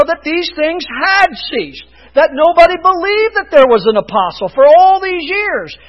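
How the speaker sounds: high, loud, forceful sermon challenge; impassioned preaching. Real, not acted. Preacher challenging the church with the great reformers, impassioned preaching. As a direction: fire rhetorical questions at the congregation, hammering key names.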